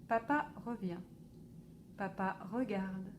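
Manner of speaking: ordinary delivery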